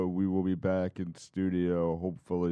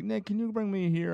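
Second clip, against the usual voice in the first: put-on old lady voice; 11 semitones higher than usual. Grandma quoted asking for rides.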